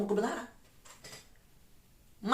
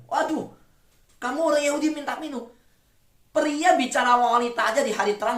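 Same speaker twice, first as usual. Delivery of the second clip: surprised woman; 5 semitones higher than usual. Acted. Samaritan woman answers Jesus.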